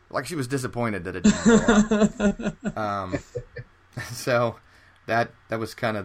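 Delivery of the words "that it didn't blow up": laughing. Real, not acted. Teller laughing at the punchline of his airport story. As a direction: laugh the punchline out, higher and louder.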